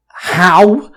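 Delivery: loud, high startled question; surprised. A narrator's reading. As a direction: ask in shock.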